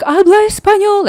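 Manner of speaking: high, loud mock yell; shrieky impersonation of the shouting girl; imitated screaming. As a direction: mimic her exasperated Spanish demand. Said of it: "screaming and shouting on me".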